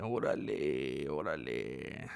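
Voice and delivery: deep, slow, lazy órale; low drawn out órale. Pretend. Streamer greeting chat with a drawn-out low órale.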